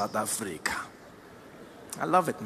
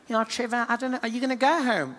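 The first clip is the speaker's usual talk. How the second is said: worried expat; 4 semitones higher, 3 dB quieter than usual. Acted. Voices a complaining South African expat.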